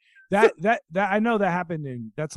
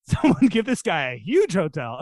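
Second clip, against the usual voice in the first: loud, higher-pitched mock enthusiasm; amazed crowd; 4 semitones higher, 7 dB louder than usual. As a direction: gush loudly like an impressed crowd. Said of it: "Everyone's like".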